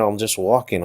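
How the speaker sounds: normal talking voice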